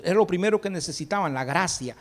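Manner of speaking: normal talking voice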